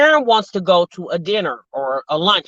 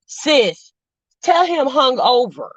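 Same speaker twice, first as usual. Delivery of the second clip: ordinary talk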